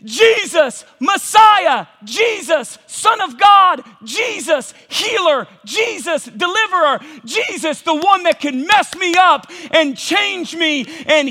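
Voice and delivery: loud, high, rising cry of names; fervent confession. Acted. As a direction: shout each name like a plea. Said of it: "what Bartimaeus is confessing is".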